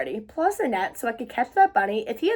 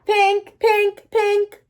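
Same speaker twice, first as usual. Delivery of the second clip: alarm ringing; 8 semitones higher, 6 dB louder, much slower than usual. Acted.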